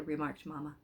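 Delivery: plain reading voice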